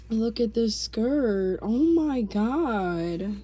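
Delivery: loud, drawn-out fangirl gushing; gushing delight. Real, not acted. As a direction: stretch each phrase slowly, loudly thrilled. Gushing over a new skirt.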